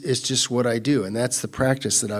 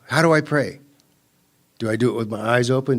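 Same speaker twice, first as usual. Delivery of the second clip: usual speaking voice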